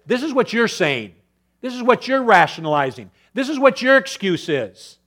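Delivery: high, loud preaching rebuke; God's rebuke. Acted. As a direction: lay bare their excuses as God. God's voice exposing excuses.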